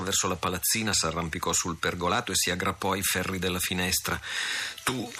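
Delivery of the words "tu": higher, joyful exclamation; crazy with joy. A narrator's reading. The lady sees Sandokan at her window, higher and overjoyed. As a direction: cry out in delighted disbelief. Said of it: "esclamò la giovinetta, pazza di gioia".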